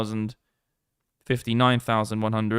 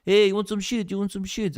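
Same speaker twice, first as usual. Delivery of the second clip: a higher, wheedling mock dealer pitch; pushy street dealer; 7 semitones higher than usual. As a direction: hawk it like a street dealer working tourists. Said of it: "You have people trying to sell you drugs".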